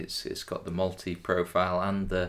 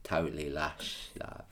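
put-on Essex accent; 7 dB quieter than usual.

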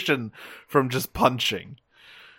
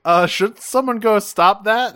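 higher, tentative onlooker voice; lightly voiced worried kids; 7 semitones higher, 3 dB louder than usual. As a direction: ask like an uneasy kid watching a fight. Kids watching a fight, retold.

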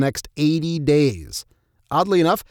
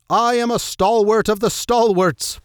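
proclaiming; 6 semitones higher than usual. Acted. Quoting assassin proclaiming.

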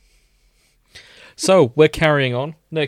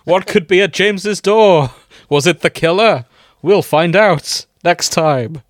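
mock cliffhanger teaser; 5 semitones higher, 8 dB louder, slower than usual. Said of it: "The suspense of two weeks".

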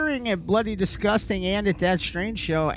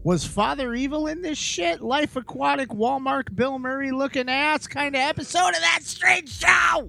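show announcer; 5 semitones higher than usual. Acted. Host's wacky show opener.

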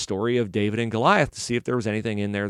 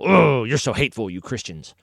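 mock disgust. Critics accusing Christians, mock disgusted. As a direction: groan the accusation, then let it fall away. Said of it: "where people go".

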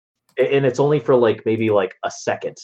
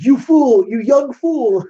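much higher and louder, sneering villainess; scornful Belladonna; 14 semitones higher, 6 dB louder, much slower than usual. Retold or imitated. Belladonna scolding the young thief, high sneering voice. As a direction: spit it out like a haughty sorceress.

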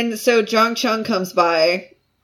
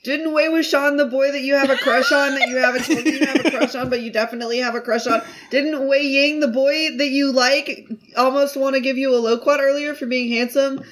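gleeful teasing; 7 semitones higher than usual. Retold or imitated. Teasing Lan Wangji, escalating.

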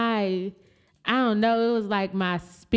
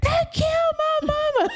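high, loud cry with a laugh; hysterical news; 3 dB louder than usual. Retold or imitated. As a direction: retell the frantic call, laughing. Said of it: "I was like".

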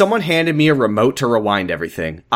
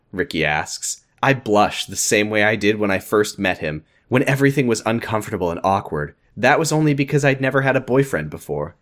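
usual speaking voice

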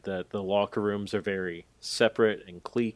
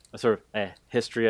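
air quotes; 3 semitones higher, 6 dB louder, much faster than usual. Shown as a demonstration. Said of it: "I'm doing quotations right now for the listeners".

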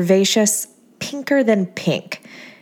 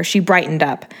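usual speaking voice

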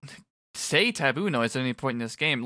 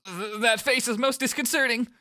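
Nagi voice; 11 semitones higher than usual. Acted. Reading Nagi's line, high voice.

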